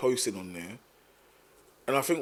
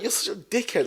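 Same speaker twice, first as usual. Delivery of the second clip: mock insult; 9 semitones higher than usual. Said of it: "Like, like".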